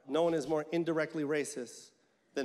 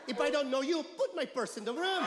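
higher mom voice, half-shouted order; mom snapping; 7 semitones higher than usual. Retold or imitated. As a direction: bark the order like a strict mother. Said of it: "she goes".

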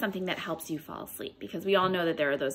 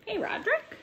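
lively storybook read-aloud with character voices; picture-book storytime voice; reading to children; 4 semitones higher than usual. Acted. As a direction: read it to a class of little ones. Teacher reads picture book aloud.

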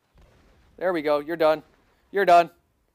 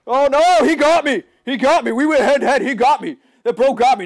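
high, loud, with a laugh; excited dismay; 7 semitones higher, 6 dB louder than usual. Real, not acted. Gamer losing a head-to-head duel, high and loud. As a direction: yelp it out, half laughing. Reaction to being killed.

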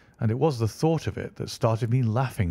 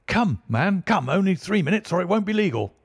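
high, loud rushed pleading; frantic urging; 9 semitones higher, 4 dB louder than usual. A narrator's reading. Godfrey hurrying the witness before the deadline, frantic. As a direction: press him to hurry before time runs out.

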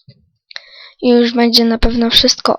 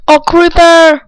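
gasp, then high, loud and slow; startled; 6 semitones higher, 6 dB louder, slower than usual. Real, not acted. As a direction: caught off guard by a monster. Creeper suddenly appears. (zaskoczony okrzyk)

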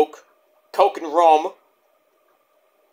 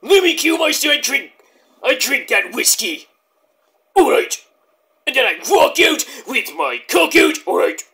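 [name] impression; 7 semitones higher than usual. Vlogger imitating [name] bragging about whiskey. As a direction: ham up the hard-drinking rock legend. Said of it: "like [name]".

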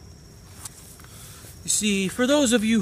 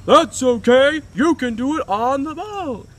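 sitcom dad; 7 semitones higher, 7 dB louder than usual. Retold or imitated. Voicing Brian's cheery dad.

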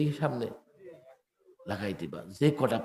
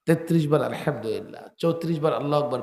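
usual speaking voice

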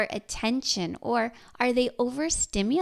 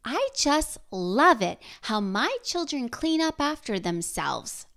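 sarcastic; 3 semitones higher than usual. Shown as a demonstration. Parent about children not cleaning up, sarcastic. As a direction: overplay the love with sarcastic emphasis. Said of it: "sarcasm does not work".

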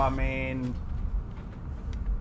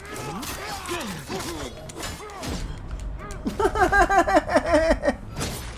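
high giggle; 8 semitones higher, 3 dB quieter than usual. Real, not acted. Laughs at his own joke.

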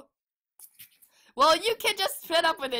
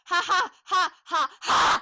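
fake laugh; 3 semitones higher, 4 dB louder than usual.

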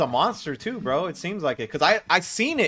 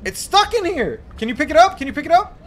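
panicking gamer; 11 semitones higher, 5 dB louder than usual. Real, not acted. Trapped, begging friend.

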